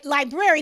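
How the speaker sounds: "library" said as lie-brair-ee; "library" pronounced as it is spelled; both Rs sounded. Shown as a demonstration. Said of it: "because it is spelled L I B R A R Y".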